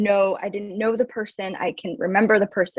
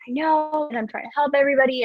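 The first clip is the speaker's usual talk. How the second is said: higher, fast eager know-it-all; mock Hermione Granger; 6 semitones higher, faster than usual. Mocking her teen know-it-all self, eager and quick. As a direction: rattle it off like the class swot. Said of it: "what I call the Hermione Granger".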